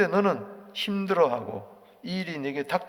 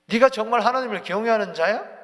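loud, higher, accusing question; accusing challenge; 6 semitones higher, 10 dB louder than usual. Acted. Eliphaz loudly challenging Job's fear of God. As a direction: throw the doubt in his face. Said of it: "이렇게 이야기한다는 거죠".

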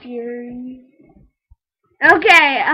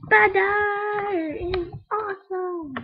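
awed gushing; 6 semitones higher, slower than usual. Real, not acted. Gushing over butter pick.

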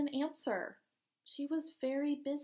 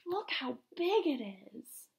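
ordinary delivery, nothing marked

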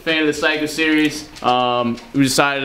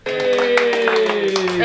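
ordinary talk, nothing marked

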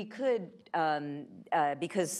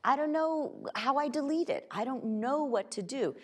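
imitating baffled Americans, pitched up high; higher voice of a helpless user; bewildered users; 10 semitones higher than usual. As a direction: voice someone at a loss. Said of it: "And so they're left thinking".

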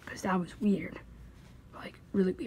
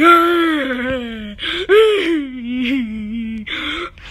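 high, wailing mock sob; hammed-up wailing cry in a far-off voice; crying noise; 4 semitones higher, 8 dB louder than usual. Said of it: "What's that crying noise?".

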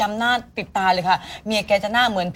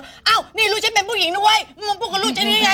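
higher, mock angry telling-off; mother's retold yell; 9 semitones higher than usual. Mother scolds the shop owner over her daughter, mock angry shout. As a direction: replay mom's fury at the customer, pitched up. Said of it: "โวยวายกันลั่นร้าน".

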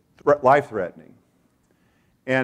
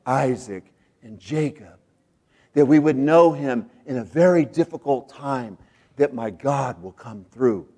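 usual speaking voice

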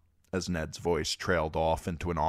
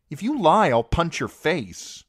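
put-on loud, furious child's threat; fierce threat; 12 semitones higher, 7 dB louder than usual. Arya threatening the squire, loud and fierce. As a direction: snap it out as a warning punch.

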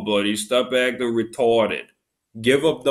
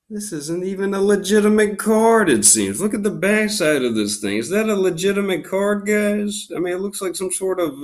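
high, exasperated protest; incredulous; 8 semitones higher than usual. Real, not acted. Card opener asking viewers if the card is legitimate, incredulous. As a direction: appeal to viewers in pitched-up disbelief.

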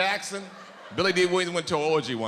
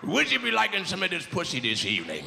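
low gruff grunt; 7 semitones lower, slower than usual. Acted. Pirate-like woman in bed.

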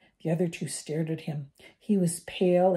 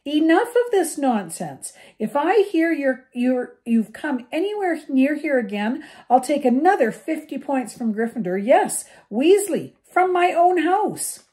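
higher and louder angry teacher; furious scolding; 9 semitones higher, 8 dB louder than usual. A narrator's reading. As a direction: lay down the law, fuming.

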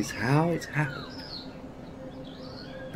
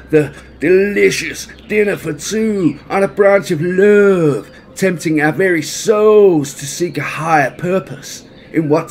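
loud, high, sing-song bear; hammed-up romantic bear; jolly bear voice; 5 semitones higher, 7 dB louder than usual. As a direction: croon it like a lovesick bear. Baloo's sing-song mango speech.

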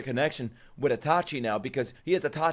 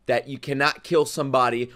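usual speaking voice

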